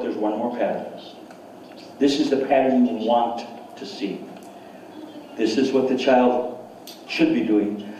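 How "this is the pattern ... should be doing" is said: usual speaking voice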